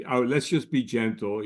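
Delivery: ordinary delivery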